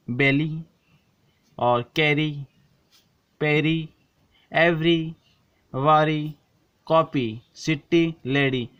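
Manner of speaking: each word set apart, ending on a short ee; model words. Shown as a demonstration. Teacher lists example words for students, each set apart. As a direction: list the words one by one, stressing the final ee. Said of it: "छोटी ई का उच्चारण हो रहा है".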